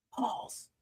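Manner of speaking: a breathy whisper, pause. Acted. Whispering pause to the audience as a reminder. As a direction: hush the word pause like a secret.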